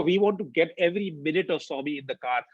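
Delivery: ordinary delivery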